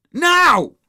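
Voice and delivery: explosive high, very loud command; bellow. A narrator's reading. Henry demands his snacks at once, bellowing. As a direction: bellow it with full force. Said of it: "he bellowed".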